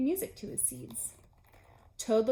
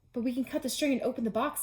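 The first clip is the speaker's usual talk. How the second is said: fast; 3 semitones higher, faster than usual. Acted. Toad quick protest.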